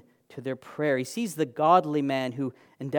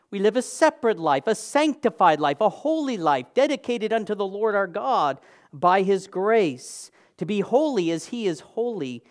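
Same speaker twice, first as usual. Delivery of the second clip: louder, higher preaching build; fervent preaching; 5 semitones higher, 5 dB louder than usual. Acted. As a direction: preach it out with rising fervor. Preaching at full force.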